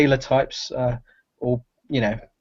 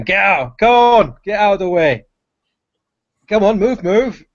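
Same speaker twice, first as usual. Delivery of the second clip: put-on loud, higher gruff barking in a strong English accent; shouting through a crowd; 7 semitones higher, 8 dB louder than usual. Big fish porter shoving through the crowd, loud English shouting. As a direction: bellow at people to clear the way.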